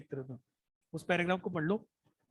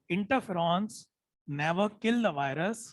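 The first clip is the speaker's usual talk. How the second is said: slow dictation; much slower than usual. Shown as a demonstration. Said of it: "मैं जानबूझ के धीरे बोल रहा हूँ".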